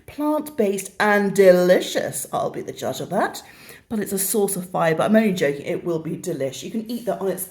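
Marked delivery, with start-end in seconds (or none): 0.1-2.3 slow, deliberate ad-style slogan with a rise; slow mock advert read. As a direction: sell it like a product tagline. Reading the product's slogan.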